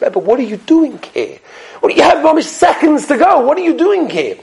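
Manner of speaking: high, urgent what are you doing here; a touch of frantic worry; alarmed question. Retold or imitated. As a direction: students pleading with their rebbe, high and urgent. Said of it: "They said".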